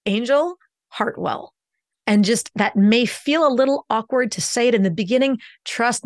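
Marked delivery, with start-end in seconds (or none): 0.1-1.5 "Angel" rises slowly, pause, "Hartwell" falls; first up, last down. Shown as a demonstration. Coach modelling the host's name, slowly up then down. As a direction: lift "Angel", break, then land "Hartwell". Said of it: "put our first name on the up".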